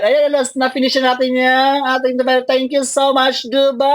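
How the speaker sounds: high, loud, elated thanks; excited. Real, not acted. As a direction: celebrate finishing the race. Crossing the finish line.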